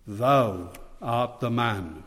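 slow, grave pronouncement, a lightly voiced prophet; solemn prophet's accusation. A narrator's reading. Prophet Nathan confronting King David, slow and grave. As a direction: lay the verdict down slowly like a prophet. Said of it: "Nathan said".

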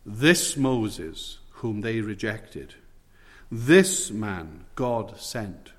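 "This Moses whom they rejected, this man God sent" drawn out very slowly, leaning on "this"; slow, weighty stress on "this"; slow emphatic reading. Shown as a demonstration. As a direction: stretch every word out, hitting "this" hard. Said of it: "I can emphasize it like this".

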